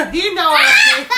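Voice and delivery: high, loud, laughing through words. Real, not acted. Mom laughing after son refuses to speak, loud and high.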